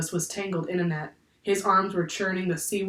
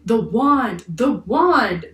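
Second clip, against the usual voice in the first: loud, raised exclamation, repeated; urgent interruption; 4 semitones higher, 7 dB louder, much slower than usual. A narrator's reading. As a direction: cut in urgently. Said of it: "Jack interrupted her".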